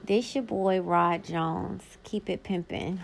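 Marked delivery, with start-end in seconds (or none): none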